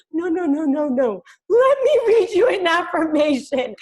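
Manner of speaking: high and loud mock mom, pushing; insistent mom. Daughter imitating her mom insisting on reading an affirmation. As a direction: ham up a mother who won't take no.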